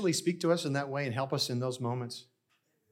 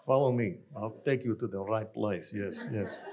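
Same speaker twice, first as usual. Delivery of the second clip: put-on Russian accent; 4 semitones lower than usual. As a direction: ham up the clerk's Russian accent. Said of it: "he becomes Russian in my story".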